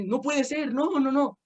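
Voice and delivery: high-pitched imitation of a distressed mother; mom's despair over the stolen car, high mock wail; retold wail. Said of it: "Así".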